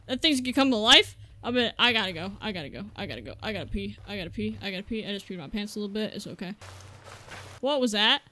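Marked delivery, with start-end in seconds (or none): 1.4-6.5 nervous muttering. Real, not acted. Panicked muttering after a scare.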